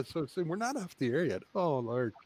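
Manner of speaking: normal talking voice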